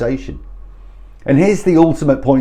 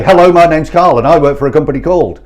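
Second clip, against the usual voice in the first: fast, louder formal introduction; over-keen telesales patter; mock scripted caller; 3 semitones higher, 6 dB louder, faster than usual. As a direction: rattle off the script as a parody. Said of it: "you sound like you need a bit of therapy".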